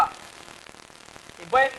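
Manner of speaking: ordinary talk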